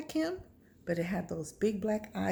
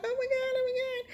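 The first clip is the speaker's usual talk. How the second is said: very high, whimpering squeal; acted panic; faster than usual. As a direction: whimper it as if looking round in terror. Retells looking around in panic.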